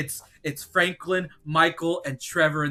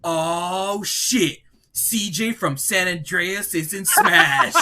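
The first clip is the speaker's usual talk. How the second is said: higher, louder, drawn-out announcement; hyped reveal; 5 semitones higher, 5 dB louder, much slower than usual. Acted. Announcing the trailer's title to a friend, hyped reveal. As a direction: build to a big stretched final word.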